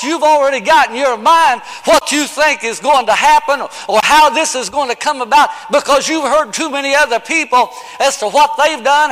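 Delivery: ordinary talk, nothing marked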